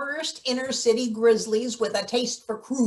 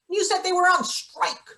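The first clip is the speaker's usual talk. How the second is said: higher, worried and quickening; anxious, rising pitch; 7 semitones higher than usual. Acted. Arguing about the mailman, pitch climbing anxiously. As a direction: voice climbing with worry.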